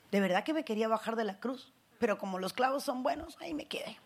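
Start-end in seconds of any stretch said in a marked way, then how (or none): 2.0-4.0 hushed joking aside; whispered aside. Retold or imitated. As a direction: whisper it like a wry confession.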